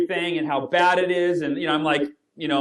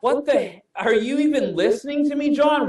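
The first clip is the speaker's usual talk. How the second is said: raised, exasperated what-the-hell outburst, half laughing; irritated disbelief; 6 semitones higher than usual. Retold or imitated. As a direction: act offended that your friend isn't listening. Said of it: "I start to get really, like, irritated".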